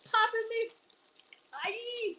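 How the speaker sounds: high-pitched yelp from popping oil; stung yelping. Real, not acted. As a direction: squeal as hot oil catches you. Hot oil pops on her.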